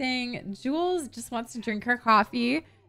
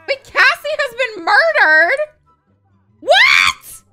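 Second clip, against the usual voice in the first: high, loud incredulous shriek; shocked disbelief; 11 semitones higher, 11 dB louder, slower than usual. Real, not acted. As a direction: yell the question in disbelief. Shocked by in-game murder.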